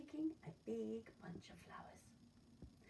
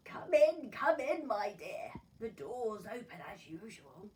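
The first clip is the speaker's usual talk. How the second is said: put-on old granny; 11 dB louder than usual. Granny invites the visitor in.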